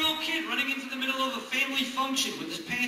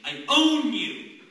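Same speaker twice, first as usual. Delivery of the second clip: slow commanding owner; 4 semitones higher, 11 dB louder, much slower than usual. Acted. Owner to dog.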